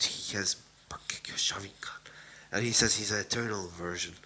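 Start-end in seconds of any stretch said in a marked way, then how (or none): 0.0-2.0 breathless stunned whisper; hushed awe at the reveal; shocked whisper. Acted. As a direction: whisper it in disbelief.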